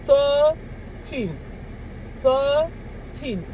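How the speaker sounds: put-on THIRTEEN split with a gap, THIR held high, TEEN dropping; over-careful thirteen. Shown as a demonstration. Showing the correct way to say it, over-careful THIRTEEN. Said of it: "I have to say it like this".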